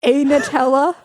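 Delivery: loud, high, slow mocking translation, laughing at the end. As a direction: translate it back loudly, teasing. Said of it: "so you just said".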